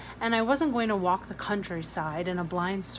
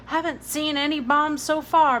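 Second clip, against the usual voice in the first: higher, louder working-class mother; Billy's mother; 6 semitones higher, 6 dB louder than usual. A narrator's reading. Billy's mother to Jamie, louder and dismissive. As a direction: brush it off like a London mum.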